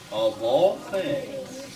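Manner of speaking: drawn out slowly; slow emphatic. Shown as a demonstration. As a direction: stretch each word out. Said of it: "teacher models slow reading".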